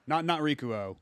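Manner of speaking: ordinary delivery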